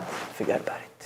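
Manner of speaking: breathy whispered forget about it; whispered aside. Real, not acted. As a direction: drop to a whisper for effect. Rabbi's emphatic closing remark.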